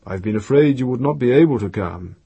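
ordinary delivery

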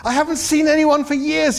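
high and loud, words tumbling out after years alone; emotional outburst. A narrator's reading. Arthur blurting to Ford after years alone, high and emotional. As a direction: let the loneliness burst out, high and loud. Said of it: "in a sudden access of emotion".

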